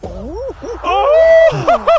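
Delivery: sudden high yelp of triumph; a joyful shriek of victory; shout. Real, not acted. Winning shout in game.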